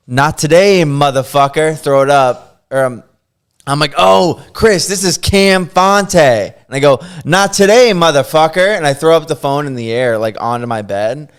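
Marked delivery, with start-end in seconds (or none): 0.1-1.8 loud, raised mock yell; re-enacted triumphant shout; retold scream. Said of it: "I just screamed this man's". 4.0-6.1 high, loud mock announcement; re-enacted shout, last words drawn out; retold scream. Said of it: "I just screamed this man's first and last name". 7.2-8.8 loud, raised mock yell; re-enacted triumphant shout; retold scream. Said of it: "I just screamed this man's".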